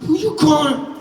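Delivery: straight-faced angry demand; stern, no smile. Shown as a demonstration. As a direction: say it without smiling, fierce. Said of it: "don't smile".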